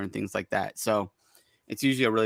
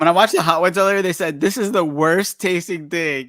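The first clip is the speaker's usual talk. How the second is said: excited; 6 semitones higher, 6 dB louder than usual. Real, not acted. Laughing about Hot Ones quote.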